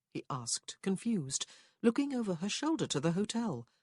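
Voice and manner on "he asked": ordinary delivery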